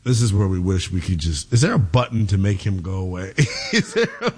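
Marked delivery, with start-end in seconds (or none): 3.7-4.4 high laugh. Real, not acted. Host breaks up laughing.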